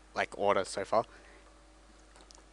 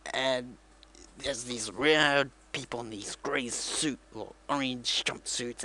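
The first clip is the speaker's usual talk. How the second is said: ordinary delivery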